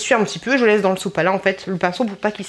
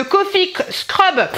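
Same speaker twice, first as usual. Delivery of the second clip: bad English accent; 9 semitones higher, much slower than usual. Real, not acted. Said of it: "accent anglais dégueulasse".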